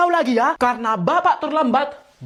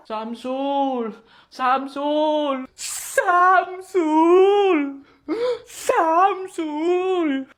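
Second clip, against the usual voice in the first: calling out, growing desperate; 3 semitones higher, much slower than usual. Acted. Search party calling lost boy.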